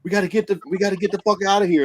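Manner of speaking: high-pitched, hurried imitation of a panicked group; retold yelling. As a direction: mimic people yelling to get out, quick and high. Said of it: "everybody's yelling at me".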